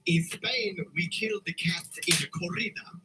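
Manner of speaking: mock Spanish accent. Matador character boasting of bullfights.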